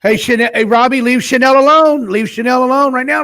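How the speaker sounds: ordinary talk, nothing marked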